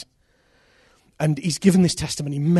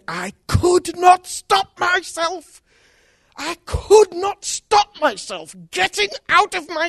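high, slow, every word weighed; drawn out in awe; slow emphatic testimony; 12 semitones higher, much slower than usual. Retold or imitated. Convert retells being drawn forward, slow and awed. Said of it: "And he said".

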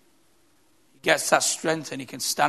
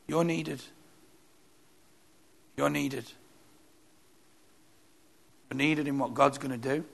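softer, tender repeat; tender reassurance; 2 semitones lower, 6 dB quieter than usual. Real, not acted. Preacher telling the congregation they matter, tender and soft. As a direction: reassure each listener quietly.

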